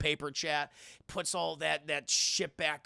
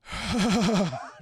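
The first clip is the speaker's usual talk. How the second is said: heavy pained exhale; 5 dB louder than usual. Retold or imitated. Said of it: "just breathing heavy".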